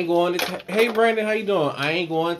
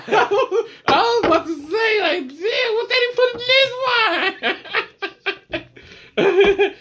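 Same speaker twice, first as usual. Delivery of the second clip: laughing; 3 dB louder than usual. Retold or imitated. Retold reaction with laughter.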